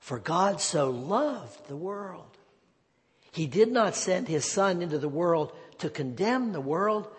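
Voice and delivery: normal talking voice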